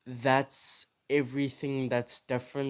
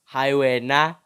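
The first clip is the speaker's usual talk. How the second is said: loud dismissal; 10 dB louder than usual. Real, not acted. Mocking the name.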